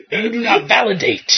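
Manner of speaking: lightly voiced menacing count, laughing through; mock Vlad the Impaler. Host voices Vlad the Impaler at the caller, laughing. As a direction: hiss it like a laughing Dracula. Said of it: "Vlad the Impaler".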